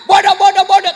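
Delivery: loud shouted "Bodoh" repeated; angry insult. Retold or imitated. Husband cursing his wife, loud and angry. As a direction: spit out each "bodoh" loudly.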